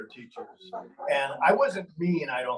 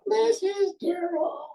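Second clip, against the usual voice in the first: much higher and slow, floating call; thrown voice; 4 dB louder, slower than usual. Retold or imitated. Student throwing his voice again, high floating call. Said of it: "the kids start giggling".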